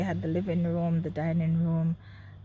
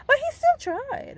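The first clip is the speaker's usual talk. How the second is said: indignant exclamation; 8 dB louder than usual. Real, not acted. Indignant outburst.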